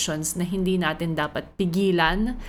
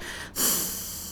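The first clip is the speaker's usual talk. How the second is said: long soft sigh out; exasperated sigh; 9 dB quieter than usual. Retold or imitated. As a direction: sigh as if cornered by a toddler. Exasperated at the question.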